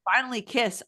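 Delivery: ordinary delivery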